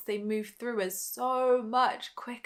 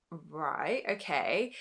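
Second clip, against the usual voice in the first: lower, softer retold reply; taken aback; 5 semitones lower, 6 dB quieter, much slower than usual. Answering the scared little voice, quietly taken aback. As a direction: climb down into a subdued acknowledgement.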